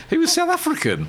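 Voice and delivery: high, laughing correction; amused disbelief. Real, not acted. Host correcting co-host about Sid James, laughing incredulously. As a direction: correct them through real laughter.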